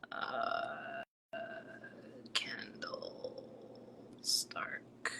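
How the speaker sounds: voiceless murmur while writing a name down; whispering to self. Real, not acted. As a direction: whisper it slowly as you jot it.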